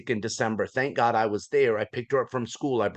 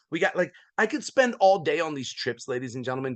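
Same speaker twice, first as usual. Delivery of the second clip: usual speaking voice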